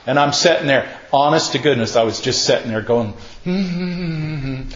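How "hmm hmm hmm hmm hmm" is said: soft, high, held-in hum; tight-lipped humming. Retold or imitated. Man sitting silent while baited, suppressed humming. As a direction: hum with the mouth shut, bursting to talk. Acts straining to stay silent.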